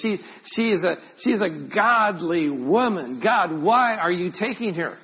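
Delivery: high, loud pleading with God; anguished protest. Retold or imitated. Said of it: "those conversations with God".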